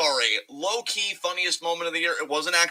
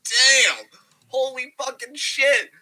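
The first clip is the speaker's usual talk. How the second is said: higher, louder awed gasp; thirsty awe; 10 semitones higher, 4 dB louder, slower than usual. Retold or imitated. Fan reacting to Roman on Twitter, higher and louder in awe. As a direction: react like you just saw your crush. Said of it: "I'm like".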